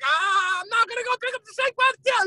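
high-pitched mock wailing protest; hammed-up panicky objection; arguing back. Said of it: "And they're arguing".

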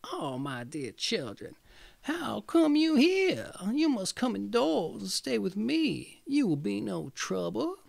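put-on high, honeyed old witch luring them in; sweet witch voice. Witch luring Hansel and Gretel indoors, high sugary voice. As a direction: coax like a sly old witch.

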